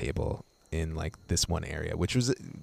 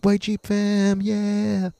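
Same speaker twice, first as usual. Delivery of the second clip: much higher, put-on hype voice; hyped-up fan voice; 6 dB louder, much slower than usual. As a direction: ham it up like a car-club bro. Jokey fan reply, later disowned.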